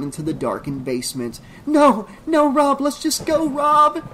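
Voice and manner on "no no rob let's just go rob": high, loud pleading scream; scream, begging him to flee; desperate scream. A narrator's reading. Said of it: "Trish screamed".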